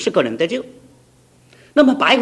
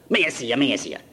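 Cantonese; 5 semitones lower, 3 dB quieter than usual. Retold or imitated. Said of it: "他问我".

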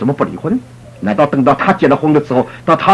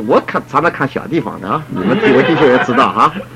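ordinary talk

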